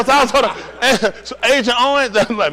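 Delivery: ordinary delivery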